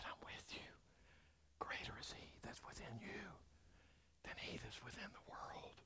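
tender unvoiced whisper of comfort; gentle intimate whisper. Shown as a demonstration. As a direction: reassure them in a whisper. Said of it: "You get closer and you say".

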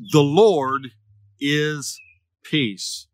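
word by word with long pauses, dropping at the end; solemn proclamation. Acted. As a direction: proclaim a sacred name slowly. Solemnly announces the altar's name.

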